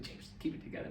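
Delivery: whispered, urgent aside; whispered warning. Retold or imitated. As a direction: hiss it under your breath to hold the laugh. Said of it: "She's like".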